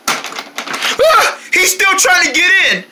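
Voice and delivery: gasp, then high-pitched alarm; frightened. Acted. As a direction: panic at an intruder. Intruder at the door.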